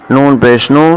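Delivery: ordinary delivery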